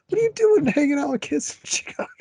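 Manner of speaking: high, disbelieving exclamation; incredulous. Retold or imitated. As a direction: squeal it in disbelief. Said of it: "And I'm like".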